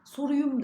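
Soruyum said with u, soruyum; mock the wrong Soruyum; wrong form. Shown as a demonstration. Teacher mimics the mistaken soruyum. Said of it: "da değil".

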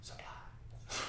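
breathed out with no voice, almost silent; hushed reverent whisper; under the breath. Acted. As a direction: whisper the word to yourself like a secret. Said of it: "I say the word under my breath".

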